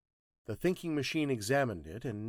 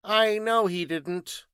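slow, high, loud assertion; terse, emphatic scientist; 10 semitones higher, 10 dB louder, slower than usual. Acted. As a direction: state it firmly, weighting each word. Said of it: "said the thinking machine tersely".

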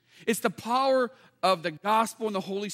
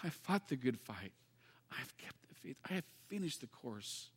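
much softer, almost a whisper, lower; a humble believer's quiet last words, much softer; humbly; 8 semitones lower, 15 dB quieter than usual. Acted. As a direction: say it humbly, barely above a whisper. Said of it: "that we could say that humbly".

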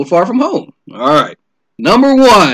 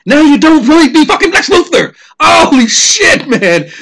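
much higher, louder disgusted outburst; outraged rant; 9 semitones higher, 5 dB louder than usual. Real, not acted. Telling listeners about Jesse Eisenberg's Lex, loud outraged rant. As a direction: let the outrage burst out, then drag holy shit.

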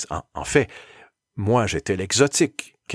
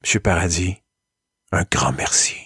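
a lightly deeper, solemn school director; grave director voice; 6 semitones lower than usual. Retold or imitated. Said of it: "et me dire". (voix grave du directeur)

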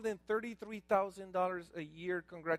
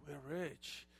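low, very soft admission; subdued bad news; 8 semitones lower, 15 dB quieter than usual. Shown as a demonstration. Preacher delivers the bad news to the congregation, subdued. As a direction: let it drop low and quiet.

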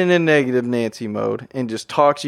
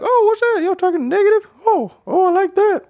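much higher, eager mock delight at gossip; nosy gossip glee. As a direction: perk up like you just caught juicy gossip. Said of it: "I'll be like".

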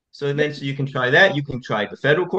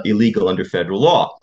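usual speaking voice

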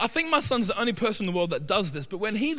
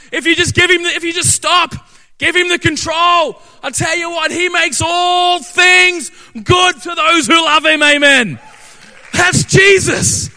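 loud, high, driving preacher's climax; preaching at full force; 7 semitones higher, 12 dB louder than usual. Real, not acted. Pastor rallying the congregation with God's victory, loud impassioned preaching. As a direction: drive it home, building to a roar.

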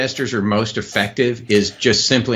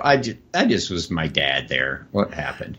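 softer, lower muttered aside; puzzled aside; 6 semitones lower, 6 dB quieter than usual. Retold or imitated. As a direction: drop your voice, baffled at yourself. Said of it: "I just go".

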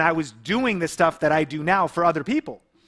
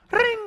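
very high, a bit louder vocal ring; imitated phone ring; 3 dB louder than usual. Telling of the third dreaded call, a mock phone ring.

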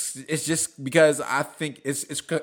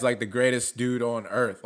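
ordinary talk, nothing marked

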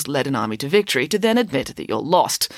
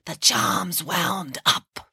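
slow witch voice; 3 dB quieter, much slower than usual. A narrator's reading. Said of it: "they all launched into their performance".

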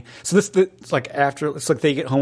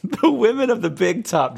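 amused teasing echo; 9 semitones higher than usual. Real, not acted.

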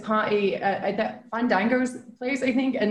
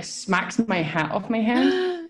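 usual speaking voice

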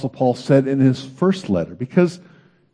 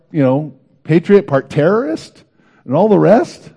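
higher, disbelieving mock exclamation; incredulous; 6 semitones higher, 3 dB louder than usual. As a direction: act out shocked disbelief at Jesus' choice. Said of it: "you go".

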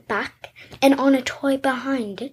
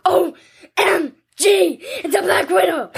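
retold alarm; 6 semitones higher, 3 dB louder than usual. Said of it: "I was like".

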